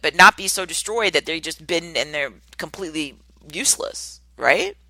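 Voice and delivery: ordinary talk, nothing marked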